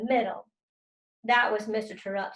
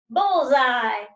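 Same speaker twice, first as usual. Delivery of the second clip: high, loud yelp of pain; shriek of pain; 6 semitones higher, 8 dB louder, much slower than usual. A narrator's reading. Lexi yelps when the frisbee hits her, shrieking. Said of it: "Lexi shrieked".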